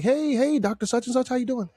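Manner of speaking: high-pitched, sugary mock greeting; exaggeratedly gentle hello. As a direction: put on a high, polite little voice. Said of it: "The soft speak pisses me off".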